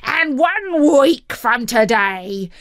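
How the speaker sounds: slow, menacing snarl; snarled threat. A narrator's reading. Sentencing someone to walk the plank, snarled slowly. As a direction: draw the threat out in a heavy snarl. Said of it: "he snarled".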